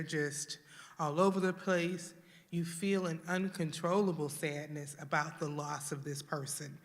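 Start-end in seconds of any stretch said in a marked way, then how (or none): none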